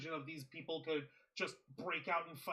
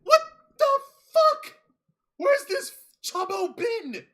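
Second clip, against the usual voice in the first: incredulous outburst; 16 dB louder than usual. Real, not acted. Co-host demanding where the returning wrestler was, incredulous outburst. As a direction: shout each piece in stretched-out astonishment.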